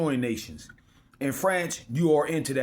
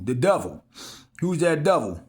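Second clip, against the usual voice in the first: normal talking voice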